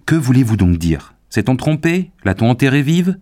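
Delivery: loud, high, rising questions; alarmed cry. A narrator's reading. Young man fearing she was buried alive, crying out. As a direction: demand answers in horrified alarm. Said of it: "s'écria-t-il".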